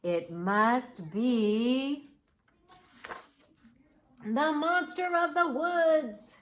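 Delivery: slow, drawn-out dread, rising in pitch; suspenseful. A narrator's reading. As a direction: stretch each word, climbing to a high finish. Building suspense before revealing monster.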